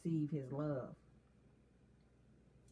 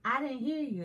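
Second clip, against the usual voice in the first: higher, mock not-hearing tease; teasing prod; 7 semitones higher, 4 dB louder than usual. Husband rubbing in his wife's apology, teasing prod. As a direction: imitate him playfully demanding to hear it. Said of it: "What you say, huh?".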